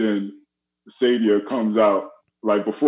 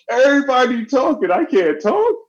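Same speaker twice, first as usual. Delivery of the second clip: high, pleading student whine; whiny protest; 13 semitones higher than usual. Retold or imitated. Student whining to Missus V about the others talking. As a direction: protest like a put-upon kid.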